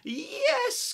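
high, loud eager cry; witch crying yes. Acted. Witch welcomes the bird aboard, high loud cry. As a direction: shout a happy yes. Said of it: "cried the witch".

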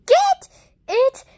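high, loud panicked crying yell; crying shout. Acted. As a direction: scream it out through tears. Character cries out in panic.

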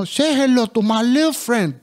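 slow movie-gangster impression; Pacino quote. Boy quoting Pacino in Scarface, mock gangster voice. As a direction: growl out the Scarface line slowly. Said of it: "είχα καβλώσει με τον Πατσίνο".

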